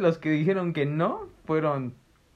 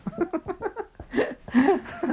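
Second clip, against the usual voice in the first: risa aguda y estridente; carcajada aguda. Real, not acted. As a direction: carcajada alta y larga. (carcajada aguda)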